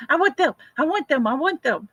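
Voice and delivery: mock grandkid whine; begging kids. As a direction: mimic kids clamoring for a treat.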